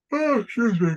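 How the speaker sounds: talking through a yawn. Real, not acted. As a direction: say it mid-yawn. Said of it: "Sorry, I'm yawning".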